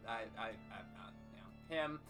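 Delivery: normal talking voice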